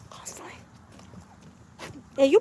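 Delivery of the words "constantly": soft whispered repeat of constantly. Shown as a demonstration. Whispering the hand-washing rule to viewers. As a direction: whisper it for emphasis. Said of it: "whispered repeat".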